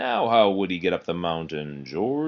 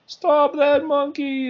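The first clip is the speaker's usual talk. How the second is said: high, slow call to stop the monkey; shouted alarm, pitched way up; yelling man; 5 dB louder, slower than usual. A narrator's reading. Racer yelling after the runaway sled, high slow shout. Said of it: "the man yelled".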